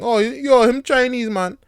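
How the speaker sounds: loud, high Jamaican patois; a hint of Jamaican relative; Jamaican accent.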